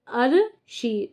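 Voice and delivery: stretched very slowly; slow teacher sounding out. Shown as a demonstration. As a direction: drag out each part for students. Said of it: "तो राज".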